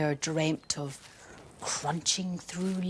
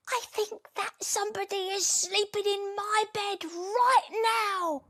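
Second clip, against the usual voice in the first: put-on high breathy child whisper; a secret whispered by a child; 12 semitones higher than usual. A narrator's reading. Little person warning of the sleeper, whispered. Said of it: "whispered the little person".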